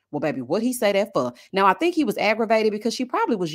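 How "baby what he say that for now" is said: ordinary delivery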